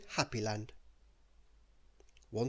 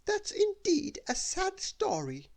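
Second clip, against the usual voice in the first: much higher, slow haughty lady voice; nose in the air; 3 dB louder, slower than usual. Acted. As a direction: dismiss him from on high. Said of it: "turning her nose up".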